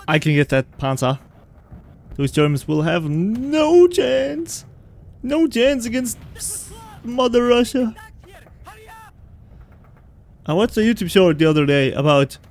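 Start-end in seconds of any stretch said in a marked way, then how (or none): 2.2-7.9 much higher, slow, hammed-up rallying cry; mock Soviet commander. Gamer mimicking the game's Russian comrade with a hammed-up rallying cry. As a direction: ham it up like a Soviet officer.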